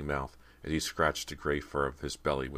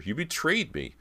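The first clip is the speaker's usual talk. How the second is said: much higher cry of betrayal; higher betrayed cry; screeching; 10 semitones higher than usual. A narrator's reading. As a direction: wail it out, hurt and scared. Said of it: "Cody screeched at her sister".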